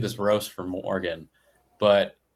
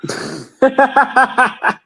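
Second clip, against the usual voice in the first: laughing; 9 dB louder than usual. Real, not acted. Joking about kidnappers' method.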